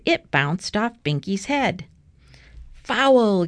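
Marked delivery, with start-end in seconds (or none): 2.7-3.5 loud, higher yell of protest. A narrator's reading. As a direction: call it out like a foul on the field. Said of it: "yelled Arthur".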